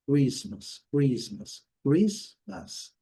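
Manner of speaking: Christmas as "cru-IS-mas", no T; correct Christmas. Shown as a demonstration. Teacher drills the word for learners, clear careful demo. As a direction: say Christmas with rounded R and silent T. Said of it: "como devemos pronunciar".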